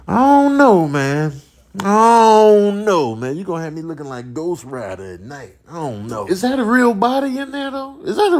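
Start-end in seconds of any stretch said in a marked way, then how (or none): none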